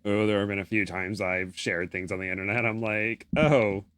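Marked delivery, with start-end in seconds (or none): none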